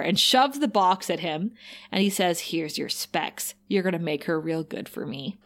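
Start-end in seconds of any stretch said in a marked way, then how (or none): 2.4-5.4 menacing Spike. Retold or imitated.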